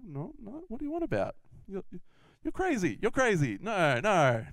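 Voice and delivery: high, stammering protest; flustered denial. Acted. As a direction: brush the accusation off in a high, swooping voice. Peter denies Jesus again, flustered.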